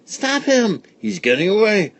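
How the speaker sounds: put-on high excited kid shouts; yelling children. A narrator's reading. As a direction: shout like kids in a chase. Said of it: "all yelling".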